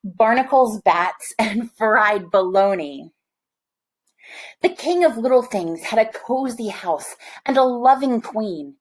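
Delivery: plain reading voice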